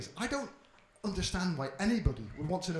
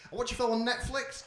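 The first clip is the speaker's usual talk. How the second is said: ordinary talk, nothing marked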